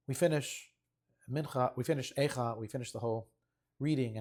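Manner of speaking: ordinary talk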